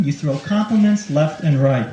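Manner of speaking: usual speaking voice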